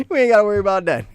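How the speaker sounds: fast, high, loud, through laughter; laughing. Real, not acted. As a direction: crack up while rattling it off. Cracks up while repeating the reassurance.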